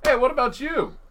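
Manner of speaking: high, loud mock British accent; badly faked British call-out. Mocking someone trying an accent, bad British accent. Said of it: "somebody trying to do a British accent".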